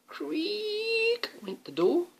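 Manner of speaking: high, slow creaking door voice; squeaky drawn-out creak; creaky door. Acted.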